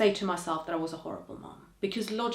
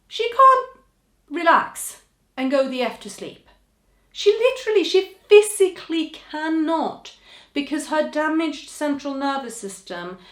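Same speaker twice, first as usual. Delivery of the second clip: much higher and louder, pleading; impassioned outburst; 9 semitones higher, 10 dB louder than usual. Real, not acted. Mother defending her spasming child, impassioned. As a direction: cry out in her daughter's defence.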